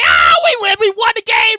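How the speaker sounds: loud excited shout; celebrating shout. Retold or imitated. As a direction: yell like a winning fan. Crowd celebrating win.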